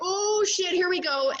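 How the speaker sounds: high-pitched here-goes retelling; giddy high self-talk; mock nervous excitement. Re-enacting the nervous moment.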